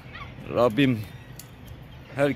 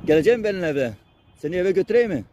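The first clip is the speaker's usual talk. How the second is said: higher, cooing pet talk; fond pet talk; 5 semitones higher than usual. Real, not acted. Feeding strays, praising and cooing at them, higher fond voice. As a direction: coo at the animals as they eat. Talking fondly to animals while feeding.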